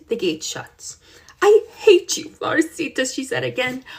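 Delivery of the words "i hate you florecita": high, loud spiteful outburst; angry. Acted. Girl shouts at the goat after locking it in, angry. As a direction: hurl the words at the penned goat. Slams goat's pen, says hate.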